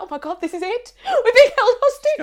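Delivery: high, loud mock terror; hammed-up panic. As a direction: ham up the hostage fear. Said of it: "And I was like".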